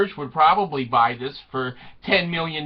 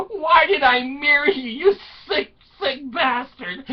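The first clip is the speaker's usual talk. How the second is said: high, hammed-up wailing reproach; mock despair; 7 semitones higher than usual. Wife regretting her marriage to schemer, mock despair. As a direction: lament it in a high comic wail.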